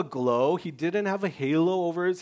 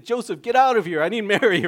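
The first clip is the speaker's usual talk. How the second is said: mock baby Jesus; 4 semitones higher, 7 dB louder, faster than usual. Baby Jesus voiced loudly.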